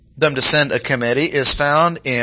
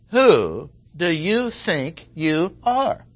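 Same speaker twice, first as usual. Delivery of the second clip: slow, haughty, cutting question; haughty challenge; 4 semitones higher, much slower than usual. Shown as a demonstration. Preacher demoing the priests' haughty question. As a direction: sneer it at the upstart, slowly. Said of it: "that's exactly the tone of the question".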